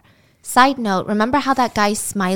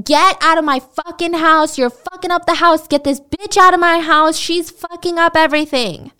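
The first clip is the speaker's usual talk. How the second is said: higher, louder mock yell at the gang; retold yelling; 8 semitones higher, 4 dB louder than usual. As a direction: shout them out of the house, hammed up a little. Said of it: "And he yells at them".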